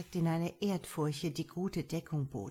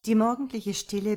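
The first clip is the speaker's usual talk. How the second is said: plain reading voice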